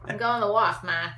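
loud mock Boston accent; hammed-up Boston townie accent. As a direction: holler it like a Bostonian, r dropped. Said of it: "in Boston it would be more like ma".

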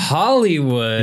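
slow, stretched echo with a swooping peak; mock amazement. Real, not acted. Co-host echoes the host's Hollywood reveal, mock amazed. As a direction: draw it out as if wildly impressed.

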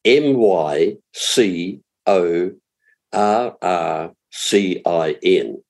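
slow letter-by-letter spelling; unhurried, spaced-out letters. Real, not acted. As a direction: spell it slowly for someone writing it down. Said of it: "have to spell that again".

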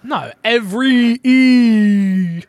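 "every" with its end E held long and loud; stretched final E. Shown as a demonstration. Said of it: "You're putting the emphasis after the R".

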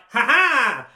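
loud, high mock villain laugh; villain's "ha ha". Imitating an ambusher behind the tree, triumphant laugh. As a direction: burst out with a hammed-up gotcha laugh. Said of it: "He's like".

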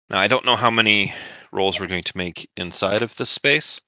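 ordinary delivery

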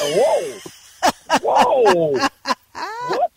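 high, delighted shock; amazed. Retold or imitated. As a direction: gasp it out in happy disbelief. Barber's amazed reaction retold.

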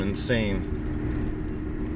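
breathy whispered "Insane" with a falling tune. Acted. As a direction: hush it, unvoiced, drawn out. Repeated word, second take whispered.

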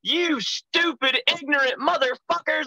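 high, strained cussing-out, drawn out; retold angry yelling. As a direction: re-enact yelling at incompetent helpers. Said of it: "I'm yelling inside this casket".

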